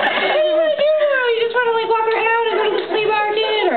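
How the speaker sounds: bright and bouncy, like nothing is heavy; cheerful partner. Retold or imitated. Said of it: "they're like".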